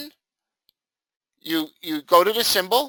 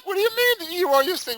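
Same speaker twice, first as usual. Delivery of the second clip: panicked confusion; 12 semitones higher, much faster than usual. Retold or imitated. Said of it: "I'm like".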